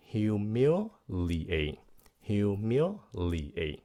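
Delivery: "humiliate" very slowly, in separate syllables; slow repeat-after-me "humiliate"; four-syllable demo. Shown as a demonstration. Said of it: "à, bốn âm".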